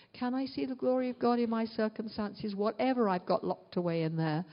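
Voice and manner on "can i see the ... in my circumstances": normal talking voice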